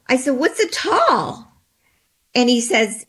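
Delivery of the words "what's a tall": baffled. Retold or imitated. Asking what the creature was.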